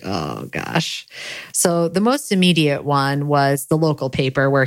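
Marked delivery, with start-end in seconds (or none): 0.0-1.1 dismayed groan. Retold or imitated. Dismayed sigh in the car.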